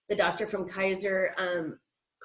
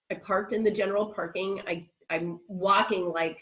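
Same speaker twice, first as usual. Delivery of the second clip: ordinary delivery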